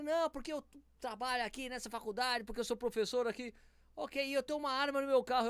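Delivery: high mocking professor voice; a hint of a boastful threat; mimicking the driver.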